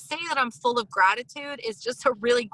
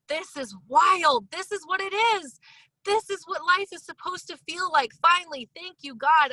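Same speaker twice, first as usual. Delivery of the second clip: joyful awe; 6 semitones higher than usual. Retold or imitated. Retold joyful inner thought.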